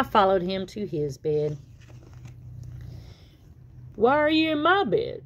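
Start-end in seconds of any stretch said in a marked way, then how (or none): 3.9-5.2 higher, puzzled dad voice; a dad surprised at a visitor; puzzled frog dad. Acted. Dad asks Tad why he is in his bed, puzzled.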